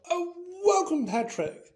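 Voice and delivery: forced, formal welcome; stiff. A narrator's reading. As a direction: greet with strained politeness. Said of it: "said Nick stiffly".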